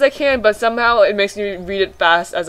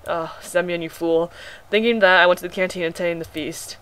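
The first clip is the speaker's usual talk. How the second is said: usual speaking voice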